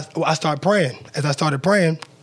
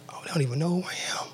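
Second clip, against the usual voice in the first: breathy voiceless whisper, almost inaudible; a hushed private thought under the breath; whispered aside; 10 dB quieter than usual. Retold or imitated. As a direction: whisper it to yourself in disbelief. Inner thought while held at gunpoint.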